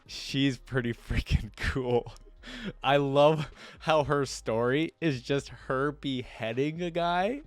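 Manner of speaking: ordinary delivery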